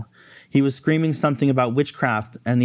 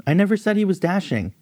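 pitched up, defensive; flustered high denial; 7 semitones higher than usual. Acted.

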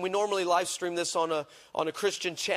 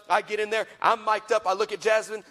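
ordinary delivery

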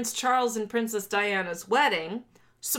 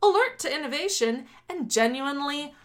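raised, oratorical political read-aloud; formal manifesto reading; 6 semitones higher than usual. Acted. Host reading privatization section to co-host, declaimed. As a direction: declaim it in rising, emphatic phrases.

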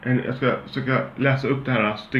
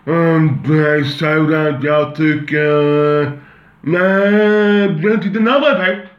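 much higher, louder, slow and dragging mock voice; mocking dumb dialect; 6 semitones higher, 7 dB louder, much slower than usual. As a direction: ridicule him as a slow-witted fool. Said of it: "Och det låter så här".

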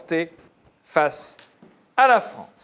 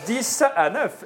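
ordinary talk

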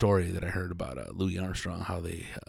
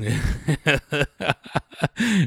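hearty laugh, voice a touch high; 7 semitones higher than usual. Real, not acted. Host laughing at the mic guy's boast, hearty laugh.

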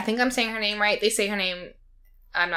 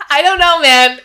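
laughing; 6 semitones higher, 10 dB louder than usual. Real, not acted. Laughing reply.

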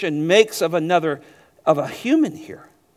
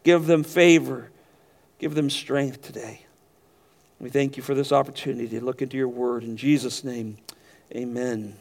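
prayer; 3 semitones lower than usual. Acted. Pastor closes a prayer.

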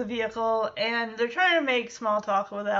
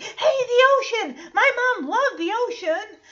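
much higher, excited exclaiming about the ocean; excited kid; 10 semitones higher, 4 dB louder, faster than usual. Retold or imitated. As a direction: imitate a kid thrilled to spot the ocean. Elvis leaning over, excited about ocean.

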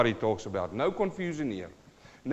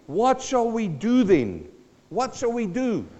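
high, slow anxious question; pleading crowd; 8 semitones higher, 4 dB louder, slower than usual. Acted. The people asking John the Baptist, lightly voiced anxious plea. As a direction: ask like a worried crowd.

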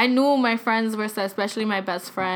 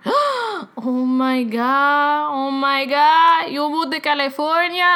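slow, higher, drawn-out imitated dismay; stretched-out disbelief, pitched up; abuela's shock; 4 semitones higher, 4 dB louder, slower than usual. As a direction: imitate a grandmother stunned by the news.

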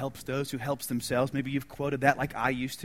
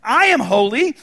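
declaring; 9 semitones higher, 14 dB louder than usual. Acted. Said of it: "God, doesn't he say himself".

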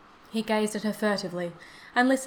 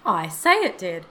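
firm, louder retort; insistent; 8 dB louder than usual. A narrator's reading. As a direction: insist on it, pushing the words out. Old man insists after being doubted.

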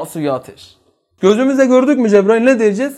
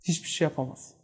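quiet hopelessness; 4 semitones lower, 15 dB quieter than usual. Acted. Dying man helpless.

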